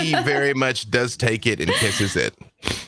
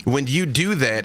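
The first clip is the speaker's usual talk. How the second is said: ordinary talk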